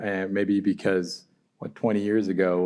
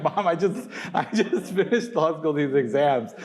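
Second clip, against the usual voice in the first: exasperated protest; 9 semitones higher than usual. Retold or imitated. Acted protest to mom.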